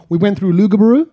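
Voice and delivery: Lugabaroo said "LOO-ga-ba-ROO", loud; loud, rising mangled Lugabaroo; American mispronunciation. Retold or imitated. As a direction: say Lugabaroo with two big "oo" sounds. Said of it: "I once had an American say".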